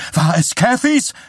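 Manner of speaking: high, loud panicked question; wife crying out in fear at the news; frightened cry. A narrator's reading. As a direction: cry out in alarm. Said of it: "schrie Shelley Chatsworth".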